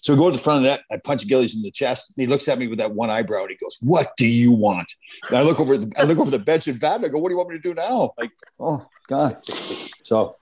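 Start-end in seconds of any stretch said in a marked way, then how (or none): 3.8-4.9 slow, drawn-out mock challenge; slow retold confrontation; menacing challenge. Retold glare from punched opponent. 7.2-9.4 higher-pitched mock panicked question; retold helpless appeal, pitched up; helpless plea. Retold plea to coach after punch.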